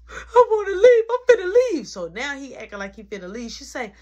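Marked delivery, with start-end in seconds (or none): 0.1-1.9 loud, high crying wail as the brother; mock tearful outburst. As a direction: imitate him wailing in protest. Imitating brother tearfully threatening to leave.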